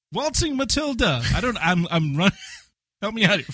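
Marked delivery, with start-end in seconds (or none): 0.1-1.2 high, singsong stab at Australian; half-hearted Australian accent. Pretend. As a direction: barely attempt the Australian accent, pitched high. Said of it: "You're not even trying the accent anymore".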